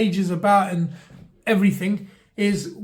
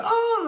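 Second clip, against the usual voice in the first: mock wail; 14 semitones higher than usual. Shown as a demonstration. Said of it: "so we can go".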